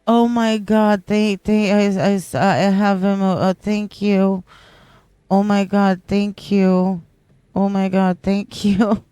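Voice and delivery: loud, breathless thank-yous; overwhelmed excitement. Real, not acted. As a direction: blurt out your thanks, overwhelmed.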